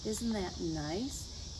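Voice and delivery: ordinary delivery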